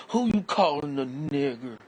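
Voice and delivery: high, loud angry threat; shouting back at the insult, full fury; furious yell. Acted. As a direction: bark it at him ready to fight. Repeated confrontation line.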